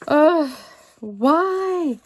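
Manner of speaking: high, loud exasperated ugh why; exasperated groan. Real, not acted. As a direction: groan it out in real dismay. Exasperated at own tied-up papers.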